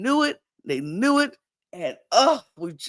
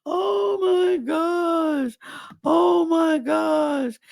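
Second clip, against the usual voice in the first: high, drawn-out cry of dismay; mock wail; 14 semitones higher, 4 dB louder, much slower than usual. Everybody learning he was faithful, mock wailing. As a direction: wail it slowly, imitating a crowd's horror.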